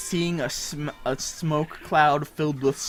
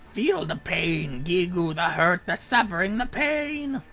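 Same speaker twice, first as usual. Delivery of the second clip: villain voice; 5 semitones higher, 4 dB louder than usual. Acted. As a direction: savor each threat.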